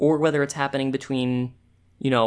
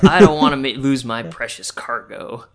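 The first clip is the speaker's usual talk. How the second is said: grizzled merchant; 6 dB louder than usual. Retold or imitated. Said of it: "You make him sound so grizzled".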